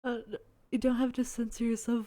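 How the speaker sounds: ordinary delivery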